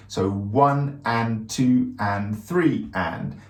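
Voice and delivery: slow, even one and two and three and; counting eighth notes aloud. Shown as a demonstration. Drum teacher counting eighths out loud in three four. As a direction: count every eighth note out loud, slowly. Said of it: "There I'm counting out loud the eighth notes".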